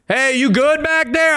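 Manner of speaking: high, far-off call to a lagging friend; calling back up trail. Retold or imitated. Skier friend calling back to him on the trail, high and light. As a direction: sing it out like a fitter buddy ahead on the trail.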